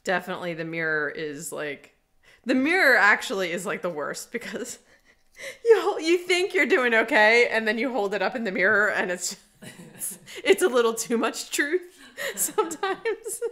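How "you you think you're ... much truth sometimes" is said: laughing through the words; giggling while explaining. Real, not acted. Laughing about the mirror.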